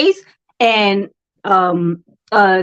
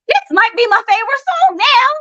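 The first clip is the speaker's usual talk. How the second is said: high-pitched, excited change of heart; suddenly won over, pitched way up; excited realization; 11 semitones higher than usual. Acted. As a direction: exclaim it like a delighted discovery. Acted sudden liking of song.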